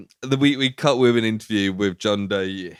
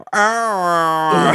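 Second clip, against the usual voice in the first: higher wobbling fake scream; mock voiced "ah"; 7 semitones higher, 4 dB louder than usual. Host mocking the film's effected scream, silly drawn-out "ah". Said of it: "they've given it the voice".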